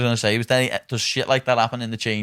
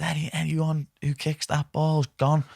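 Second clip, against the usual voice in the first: teacher warning; 6 semitones higher, 3 dB quieter, slower than usual. Retold or imitated. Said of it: "Mister Forshaw's come out and went".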